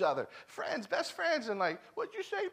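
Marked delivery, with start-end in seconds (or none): 1.9-2.5 high-pitched, softer mock challenge. Kid to his best friend before a scrap, mock challenge. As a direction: imitate a kid picking a fight. Kids squaring up to fight.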